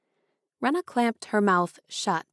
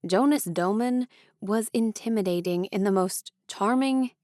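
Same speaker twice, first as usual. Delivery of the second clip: plain reading voice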